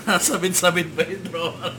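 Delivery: asking through laughter; laughing. Real, not acted. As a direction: laugh while you ask. Laughing banter while eating.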